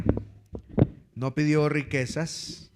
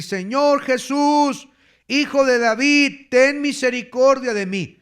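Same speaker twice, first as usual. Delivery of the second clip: crying out; 8 semitones higher, 5 dB louder than usual. Acted. Blind beggar calling to Jesus for mercy, crying out. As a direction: shout it as an urgent appeal. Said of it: "comenzó a dar voces".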